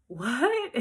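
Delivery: high, loud shocked reaction; stunned disbelief. Retold or imitated. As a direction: blurt it out in astonishment. Learns he knows Mickey.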